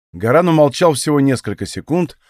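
ordinary delivery

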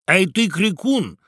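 high, loud heckling; shouted demand. A narrator's reading. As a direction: goad him loudly like a rowdy crowd. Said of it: "закричали в один голос все птицы".

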